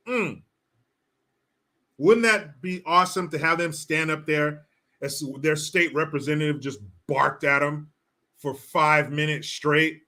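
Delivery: usual speaking voice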